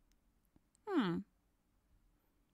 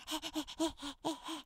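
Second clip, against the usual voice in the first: soft laugh; 6 semitones higher, 14 dB quieter than usual. Acted. After the ride.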